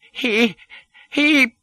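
very high, stuttered gasp of a word; shocked exclamation. A narrator's reading. Doctor sees the impostor's face, exclaiming in shock. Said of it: "he exclaimed".

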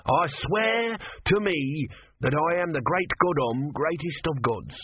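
high, slow, strange grand voice; proclaiming tortoise god. Acted. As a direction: declare your godhood grandly. Om proclaiming himself.

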